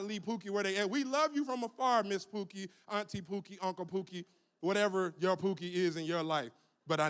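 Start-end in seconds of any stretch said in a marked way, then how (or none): none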